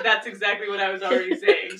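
ordinary talk

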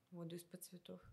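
straight-faced joke, quietly; deadpan, dead quiet. Retold or imitated. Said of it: "с серьёзным ебалом".

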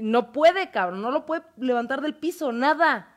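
normal talking voice

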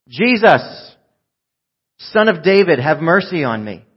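loud, high beggar's shout. Acted. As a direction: cry out for mercy over a crowd. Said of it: "so he began shouting".

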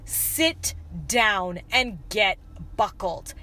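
slow, measured imitation of a calm dad; low, unhurried retold instruction with pauses. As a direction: say it once, calmly and slowly. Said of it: "Said it very calmly".

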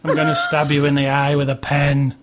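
ordinary delivery

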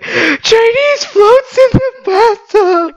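high, slow, wailing mock cry; mock sobbing. Partner imitates wife sobbing over Chinese food, high mock wail. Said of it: "And you're like".

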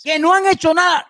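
usual speaking voice

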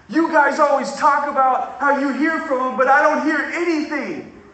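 high, loud, pleading cry for a sign; frustrated angry student. Retold or imitated. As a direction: voice the student demanding God show him something. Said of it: "and he's so angry".

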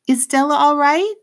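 put-on high, worried girl's voice; anxious little girl asking; young girl voice. Girl worried about gorilla.

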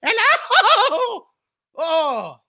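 high laugh breaking through; amused at own parody. Real, not acted. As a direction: crack up at the joke.

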